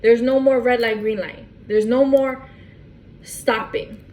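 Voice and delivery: usual speaking voice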